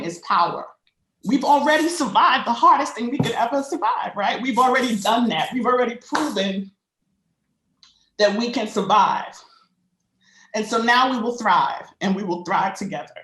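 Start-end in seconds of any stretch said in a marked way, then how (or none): 1.2-13.1 higher, voice shaking with emotion; shaky voice. Real, not acted. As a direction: push through a shaking voice. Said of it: "I'm sorry for my shaky voice".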